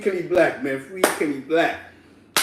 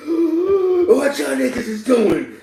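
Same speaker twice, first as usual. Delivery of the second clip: mock surprise; 10 semitones higher, 6 dB louder, slower than usual. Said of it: "He was like".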